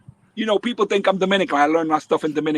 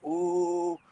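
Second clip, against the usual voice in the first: softly imitated schoolyard teasing; mocking; 8 dB quieter, slower than usual. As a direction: tease like kids watching a friend get caught. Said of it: "my boys are making fun of me".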